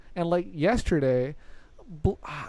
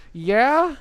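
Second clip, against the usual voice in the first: loud, high, rising "yeah?"; raised-voice query through a door; calling out questioningly; 7 semitones higher, 7 dB louder than usual. Retold or imitated. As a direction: call out to whoever is knocking. Calling out from the shower.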